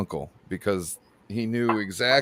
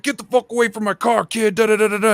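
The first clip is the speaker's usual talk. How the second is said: much higher, loud barked yell; mock angry yell; 12 semitones higher, 8 dB louder than usual. Gary Payton yelling at a kid near his car, loud and high. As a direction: shoo the kid off in a rage. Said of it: "is like".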